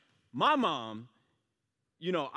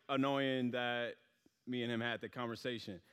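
ordinary delivery

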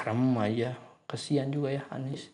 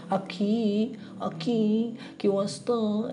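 high, drawn-out mock shout; acted calling out; 10 semitones higher, slower than usual. A narrator's reading. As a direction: call out for him, pitched well up. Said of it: "teriak, teriak mereka lantang". (teriakan memanggil)

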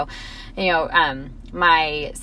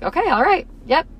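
put-on higher, bright little-boy okay; cheered-up child; 8 semitones higher than usual. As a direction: let the kid bounce back. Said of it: "was like".

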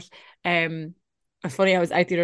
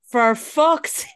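slow, louder, higher in dismay; exasperated groaned curse; 5 semitones higher, 5 dB louder, much slower than usual. Retold or imitated. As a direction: draw out the exasperation. Retold dismay as man approaches.

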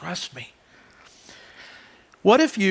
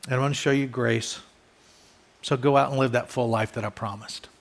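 lower, soft, gentle assurance; tender fatherly voice; reassuring God; 5 semitones lower, 5 dB quieter than usual. Pretend. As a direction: comfort them calmly and low, like a father. God voiced reassuring his children.

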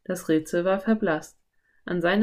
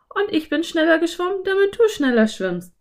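put-on high, louder, quick girl voice; Anne; 11 semitones higher, 5 dB louder than usual. A narrator's reading. Anne answers Philipp about swimming faster, high quick voice. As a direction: fire back in a high girl's voice.

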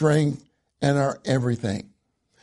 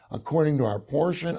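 ordinary talk

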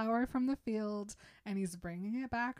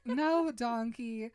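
slow, loud mock protest; hammed-up exasperated no; 3 semitones higher, 6 dB louder, much slower than usual. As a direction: drag it out to cut through the panic. Retold exasperated reply to panicking Donkey.

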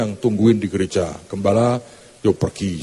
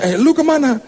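high, loud nosy question; pry into her friend's trip; nosy church lady; 3 dB louder than usual. Acted. Preacher mimics a lady loudly asking her friend where she went. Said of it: "Dia tanya sebelahnya".

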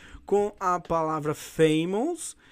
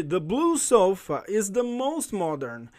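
slow model reading; slower than usual. Shown as a demonstration. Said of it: "teacher models example sentence".

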